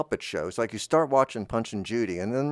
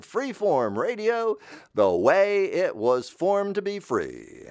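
higher, slow station ID; radio announcer; 7 semitones higher than usual. Acted. Station identification.

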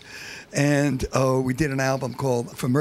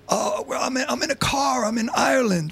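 much higher, excited caller from a car; 11 semitones higher than usual. Retold or imitated. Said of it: "This is [name]".

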